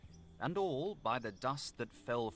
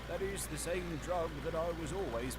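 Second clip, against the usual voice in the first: high, troubled servant voice; butler's voice; 7 semitones higher than usual. A narrator's reading. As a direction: recall it with dismay. Butler recognizes the drug.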